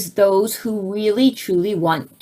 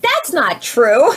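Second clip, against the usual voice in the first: indignant denial; 4 semitones higher, 5 dB louder, slower than usual. Retold or imitated. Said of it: "I was like".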